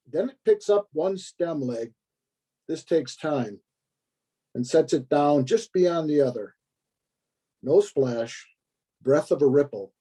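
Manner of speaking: measured poetry recital with long pauses; poem reading. Acted. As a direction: read the poem phrase by phrase, letting each image settle. Reads a poem aloud.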